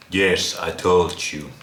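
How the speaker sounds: normal talking voice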